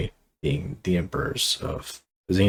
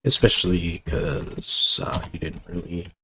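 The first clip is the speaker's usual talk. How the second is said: ordinary delivery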